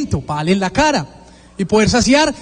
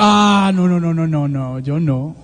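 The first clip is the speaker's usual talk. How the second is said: mock proud refusal; 5 semitones lower, slower than usual. Preacher mimics proud people refusing to reconcile, mock dismissal. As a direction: wave it off, pitch sliding down.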